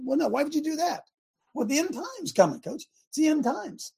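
mock excuse, dripping sarcasm; sarcastic. Mocking pastors who use the end times as an excuse, sarcastic. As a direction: play the lazy pastor's excuse with scorn. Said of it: "I'm sorry I'm so sarcastic".

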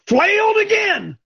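sudden high shout; high exclaim. Real, not acted. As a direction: cry it out high. Exclaims getting flailed.

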